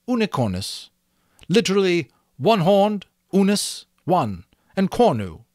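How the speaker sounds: butterfly reciting the word's roots; very clearly. A narrator's reading. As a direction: spell it out very clearly, like a dictionary. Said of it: "and then very clearly".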